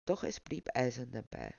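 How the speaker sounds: ordinary delivery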